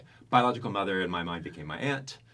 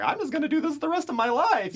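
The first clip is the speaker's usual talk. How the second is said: much higher and louder, cocky and sure; high, loud self-assurance; 8 dB louder than usual. Retold or imitated. Young telemarketer to himself, cocky and loud. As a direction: belt it out like a telemarketer who found his calling. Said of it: "and I'm like".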